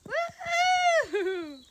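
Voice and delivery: celebratory yell; excited shout. Real, not acted. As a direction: whoop it out loud and high. Arrival at Maine border.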